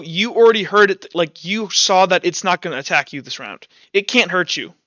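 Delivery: normal talking voice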